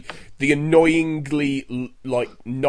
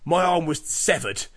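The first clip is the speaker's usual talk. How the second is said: lightly voiced action hero bragging; imitating a tough-guy boast; deadpan boast; 5 semitones higher, 6 dB louder than usual. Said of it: "my favourite one was".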